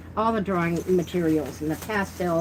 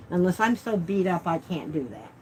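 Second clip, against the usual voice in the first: usual speaking voice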